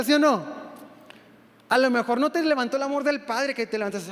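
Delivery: ordinary talk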